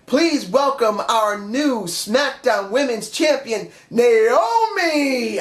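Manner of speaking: a light take on a ring announcer, drawn out and rising. Retold or imitated. Vlogger imitating Daniel Bryan's title announcement, lightly announcer-like. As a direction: call out the new champion like an announcer, slowly. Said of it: "Daniel Bryan came out and said".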